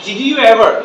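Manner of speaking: "Did you ever" with all three words sounded; learner pronunciation. Shown as a demonstration. Teacher introduces the next sentence, full "Did you ever". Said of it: "আমরা বলি যে".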